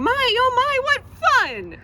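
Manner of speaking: high, loud gleeful cry; gleeful yell. Acted. Dentist yelling happily while pulling prickles, loud gleeful cry. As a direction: crow with delight.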